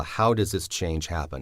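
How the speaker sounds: ordinary delivery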